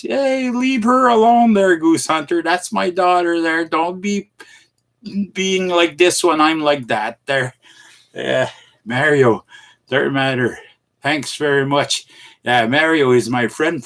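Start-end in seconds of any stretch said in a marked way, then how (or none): 0.0-7.5 high-pitched protective telling-off; protective scolding. Real, not acted. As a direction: warn someone off your daughter in a raised pitch. Defends daughter from a chatter.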